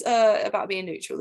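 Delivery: ordinary talk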